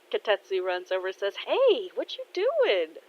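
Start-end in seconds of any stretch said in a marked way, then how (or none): 1.4-3.0 high, drawn-out exclamation; surprised concern. Acted. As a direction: fuss at a friend who shouldn't be here. Dad running over to injured partner.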